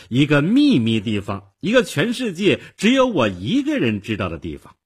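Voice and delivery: put-on high, loud, proud girl's boast; very smug. A narrator's reading. Girl boasting of her secret hideout, very pleased with herself. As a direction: show off a secret with pride. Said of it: "小姑娘非常得意地说".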